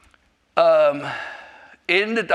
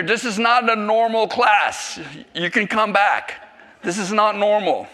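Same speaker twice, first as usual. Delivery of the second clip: higher, louder, mock-warning tone; excited banter to first-timers; playful teasing; 6 semitones higher, 5 dB louder than usual. Real, not acted. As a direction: tease the newcomers with a grin. Teasing visitors about class.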